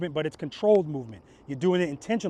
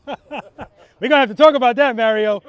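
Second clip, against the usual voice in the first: laughing; 5 semitones higher, 9 dB louder than usual. Real, not acted. Teasing a student, laughing.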